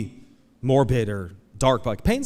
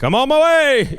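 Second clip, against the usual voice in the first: high, loud eager yell; cheery high-pitched call; eager reply; 14 semitones higher, 10 dB louder than usual. Retold or imitated. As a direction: shout it out, ready to rush over. Rushing to help dad.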